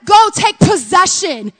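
loud command to go take the land; commanding call. Retold or imitated. Preacher voicing God's command to Israel, loud. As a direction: call out like God sending them in. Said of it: "he said".

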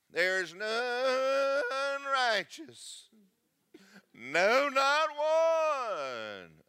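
slow, loud, crying-out scripture reading; preached proclamation. Acted. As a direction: cry out the verse from the pulpit.

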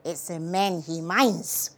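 low, soft, slow punchline; preacher father. A narrator's reading. Father explaining whom God objects to, low slow verdict. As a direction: drop down and deliver it slowly, confidentially.

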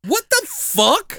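high, drawn-out squeal of disbelief; slow and very high, utterly baffled; exasperated high-pitched bewilderment; incredulous. Real, not acted. Baffled reaction to bizarre film character.

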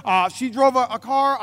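ordinary delivery

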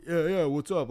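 hint of a wannabe bro; trying to sound jock. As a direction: copy a jock's casual greeting. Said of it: "try to sound like him".

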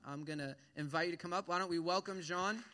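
ordinary talk, nothing marked

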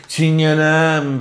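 "Çingenem" stretched very slowly, mock-syrupy; syrupy drawn-out reading. Mocking the host's syrupy poem reading, very slow drawl. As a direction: drag out "Çingenem" like a syrupy poet. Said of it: "Ağdalı okuma deriz".

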